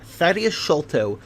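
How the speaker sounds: ordinary delivery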